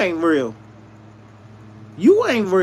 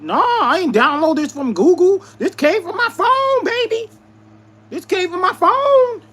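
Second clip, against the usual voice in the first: indignant protest; 10 semitones higher than usual. Retold or imitated. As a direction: retell it in a soaring, incredulous voice. Retold indignant argument with mother.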